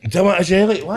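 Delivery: mocking echo. Echoing friend's offer mockingly.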